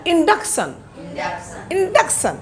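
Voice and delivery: इंडक्शन said short and clear to copy; drill. Shown as a demonstration. Teacher drilling induction for students to repeat. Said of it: "you all repeat with me".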